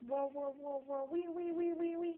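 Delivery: high squealing pig noises; piggy squeal. Shown as a demonstration. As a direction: squeal like a piglet for a toddler. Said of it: "What does a piggy say?".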